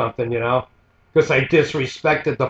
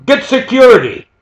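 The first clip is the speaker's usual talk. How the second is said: much higher and louder barked command; retold order shouted; 7 semitones higher, 6 dB louder than usual. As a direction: shout it at your wife to call the guards. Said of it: "to his wife".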